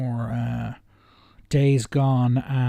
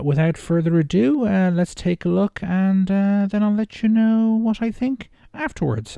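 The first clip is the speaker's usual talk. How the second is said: higher, louder upbeat host voice climbing to a chirpy toodaloo; cheery sign-off; 8 semitones higher, 5 dB louder than usual. Acted. YouTuber signing off to viewers, upbeat host voice. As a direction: bounce it out like a show host wrapping up.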